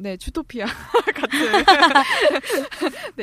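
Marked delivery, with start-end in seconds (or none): 1.4-3.1 high giggle. Real, not acted. Laughing about Zootopia.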